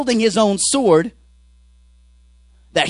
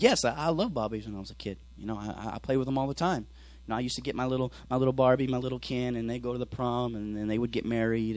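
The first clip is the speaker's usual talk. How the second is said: low, soft imitated recollection; soft reminiscing; 9 semitones lower, 13 dB quieter than usual. Woman remembering her Barbie games as a kid, soft reminiscing. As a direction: recall it quietly, voice well down.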